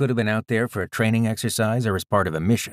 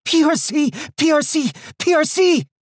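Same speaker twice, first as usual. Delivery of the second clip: high, loud, wheezy alarm call; breathless warning shout; 6 dB louder than usual. Acted. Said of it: "breathless and wheezy".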